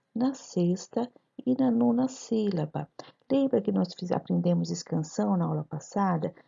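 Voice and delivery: normal talking voice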